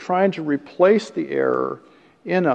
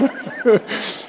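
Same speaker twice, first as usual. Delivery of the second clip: laughter; 8 semitones higher than usual. Real, not acted. Laughs at own joke.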